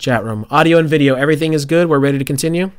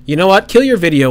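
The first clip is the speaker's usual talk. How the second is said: sudden idea; 5 semitones higher, 6 dB louder than usual. Real, not acted. Sudden fix for the stream.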